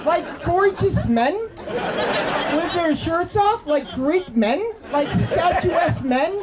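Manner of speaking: slow, dreamy and laughing; slow dreamy drawl. Acted. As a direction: drag each phrase out, lost in a fantasy. Dreamy slowed description of men.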